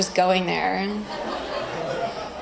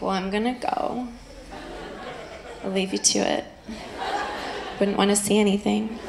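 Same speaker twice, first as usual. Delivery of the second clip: quiet, softer polite waxer; 6 dB quieter than usual. Retold or imitated. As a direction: murmur it like a tactful waxer. Waxer voiced in story.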